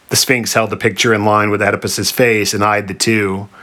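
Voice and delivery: ordinary delivery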